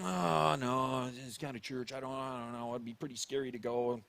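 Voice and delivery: low, soft, hesitant grumble; acted reluctant reaction. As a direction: murmur your doubts reluctantly under your breath. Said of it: "maybe your first reaction was".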